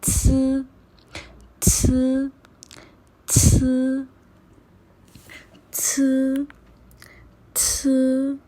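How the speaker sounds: स as a sharp hiss; pinyin c sound. Shown as a demonstration. Teacher modelling pinyin c, hissed स. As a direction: hiss स through the teeth. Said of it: "सी को pronounce करते हैं कैसे".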